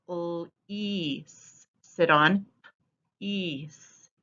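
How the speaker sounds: very slow, each sound of "Fleas sit on ease" drawn out; stretched sounds. Shown as a demonstration. Teacher stretching sounds of the line for children. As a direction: stretch every sound out long. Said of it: "stretch out the sounds of the words".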